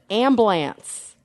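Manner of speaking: drawn-out "ambulance" squeezed to "amblance"; Baltimore pronunciation. Retold or imitated. Quoting family in a video, "ambulance" in Baltimore accent. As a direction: say "ambulance" slowly as "AM-blance", Baltimore style. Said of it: "has them pronounce them".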